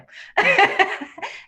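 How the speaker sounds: light self-mocking giggle; amused laugh. Real, not acted. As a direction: laugh at yourself. Laughing at own irony.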